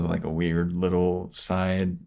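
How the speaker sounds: ordinary delivery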